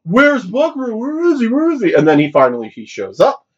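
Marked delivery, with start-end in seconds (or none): 0.0-1.9 high, worried calls of where is he; anxious searching. Retold or imitated. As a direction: look around for him in a hurry. Characters searching for the hero.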